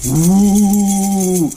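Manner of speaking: loud hammed-up fearmongering news voice; mocking imitation of a scaremongering news channel; mock infotainment anchor. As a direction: whip up panic like a cheap cable channel, drawing out each Ooh. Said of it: "Here's my imitation of most modern infotainment channels".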